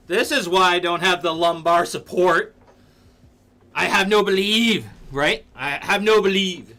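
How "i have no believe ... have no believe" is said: louder, higher playful repeat; mock loud. Streamer replaying chat's phrase, a bit louder. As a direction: savour the silly phrase.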